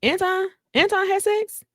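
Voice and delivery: high-pitched stunned disbelief, voice jumping up; shocked disbelief. Retold or imitated. Husband on the show learning everyone else had sex, high shocked squeak. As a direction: squeak out the shock like you just heard terrible news. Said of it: "And he was like".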